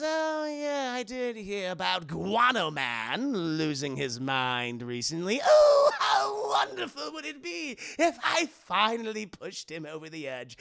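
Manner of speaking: slow villain drawl bursting into a high shout; Joker. Acted. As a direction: gloat in long swooping vowels. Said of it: "He goes".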